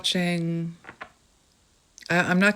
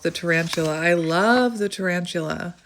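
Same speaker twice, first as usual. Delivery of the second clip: ordinary delivery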